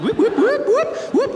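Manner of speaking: high, loud siren whoops; sharp squealed whoops; imitated police siren. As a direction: mimic a police siren with your voice. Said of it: "this time he's like".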